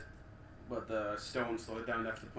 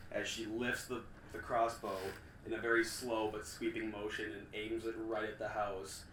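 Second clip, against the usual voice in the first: ordinary delivery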